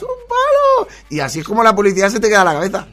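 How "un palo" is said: very high, loud mock squeal; hammed-up high cry; imitated catchphrase shout. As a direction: yell it like the advert.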